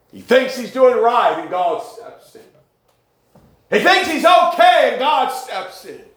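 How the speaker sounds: preacher climbing to a shout; climbing preached refrain. Acted. Repeated refrain climbing in pitch.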